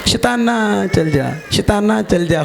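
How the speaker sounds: mocking a slow, half-hearted command to the devil; slow feeble rebuke. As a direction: drag it out weakly, like a timid prayer. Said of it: "ਹੌਲੀ ਹੌਲੀ ਆਂਦਾ". (ਹੌਲੀ ਹੌਲੀ ਮਰੀਅਲ ਜਿਹੀ ਝਿੜਕ ਦੀ ਨਕਲ)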